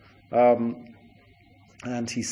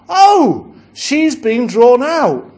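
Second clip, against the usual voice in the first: surprised crowd; 9 semitones higher, 8 dB louder, much slower than usual. Acted. People in hell notice her.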